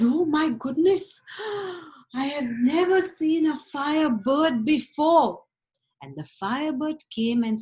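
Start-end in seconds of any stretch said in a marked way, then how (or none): 0.0-1.2 high, loud gasp of wonder; loud awestruck storyteller voice; amazed Ivan. Acted. 1.2-1.8 gasp. Acted. Ivan sees firebird. 2.1-5.4 high, loud gasp of wonder; loud awestruck storyteller voice; amazed Ivan. Acted.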